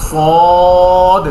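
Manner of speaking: সদ said thick and loud with the tongue cupped; heavy sad. Shown as a demonstration. Teacher showing the thick letter sad, loud and heavy. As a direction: make সদ heavy, tongue shaped like a bowl. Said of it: "মোটা করে".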